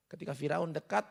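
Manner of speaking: usual speaking voice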